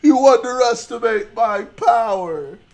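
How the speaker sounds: loud, high, drawn-out boast; hammed-up villain's defiance, slow and forceful; defiant shout. Streamer mimics a film duel, defiant shout.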